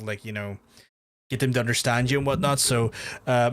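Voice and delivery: ordinary talk, nothing marked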